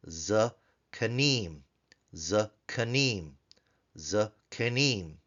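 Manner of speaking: "zakanim" in slow, separated syllables; syllable-by-syllable demo. Shown as a demonstration. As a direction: break "zakanim" into za, ka, nim with gaps. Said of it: "we see the propretonic reduction in the plural form".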